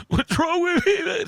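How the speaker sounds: self-mocking despair; mock exasperation. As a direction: wail it at yourself in disbelief. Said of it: "I was just like".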